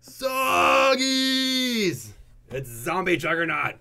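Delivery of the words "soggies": long, high, drawn-out holler; hyped shout. Pretend. Hyping up his channel's viewers, long high shout. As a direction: stretch it into a big, high yell.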